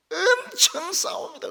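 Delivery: high, loud and slow, hammed-up outrage; mock outraged shout. Pastor telling the congregation how churches fight, in a loud mock shout. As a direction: belt it out like a scandalised preacher.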